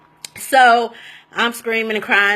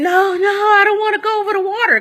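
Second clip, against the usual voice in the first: much higher, desperate pleading no; panicked screaming; 10 semitones higher, 3 dB louder than usual. Retold or imitated. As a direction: squeal it like someone terrified of sharks. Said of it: "I'm screaming".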